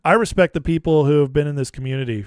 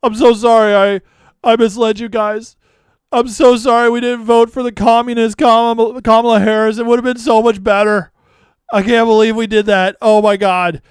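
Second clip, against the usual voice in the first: high, loud hammed-up sobbing sorry; sarcastic mock apology; 7 semitones higher, 9 dB louder than usual. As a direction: overact a fake tearful confession to mock him.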